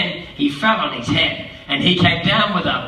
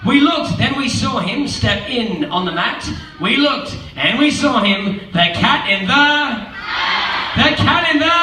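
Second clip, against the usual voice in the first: building excitement; 6 semitones higher than usual. Acted. Reading to kids, building excited toward the cat. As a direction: build up to the big entrance.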